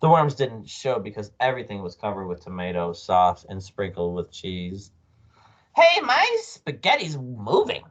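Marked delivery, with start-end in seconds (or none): 5.8-7.8 high, loud, slowed-down exclamation; startled cry. A narrator's reading. Husband seeing his spaghetti move, startled cry. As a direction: cry out in drawn-out astonishment. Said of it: "cried Mister Twit".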